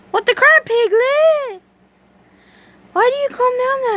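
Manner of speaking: high surprised exclamation; amazed at the pig, voice jumping up. Real, not acted. Surprised at pet.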